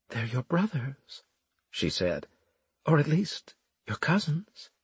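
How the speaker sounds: lightly voiced Florimel.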